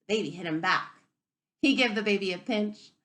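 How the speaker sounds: ordinary talk, nothing marked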